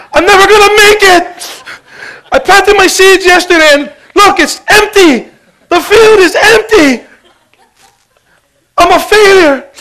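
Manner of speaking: exaggerated fake sobbing, high and loud; hammed-up crying farmer. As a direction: play the farmer whining and crying over his empty field. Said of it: "he's crying".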